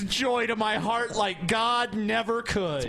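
hammed-up pretend televangelist; mock greedy preacher. Mocking the preacher.

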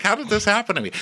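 high-pitched mock disbelief; mock dismay. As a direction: wail it in mock bewilderment. Imagines self as shocked king.

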